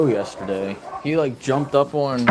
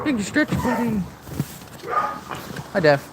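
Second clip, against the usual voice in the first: high pet voice; 9 semitones higher, 4 dB quieter than usual. Real, not acted. Owner cooing at her stretching dog, high sing-song. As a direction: coo it up high like to a puppy.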